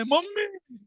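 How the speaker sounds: high-pitched mock scream; retold cry for mummy. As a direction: imitate a panicked girl calling for mum. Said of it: "भाग के चिल्लाई".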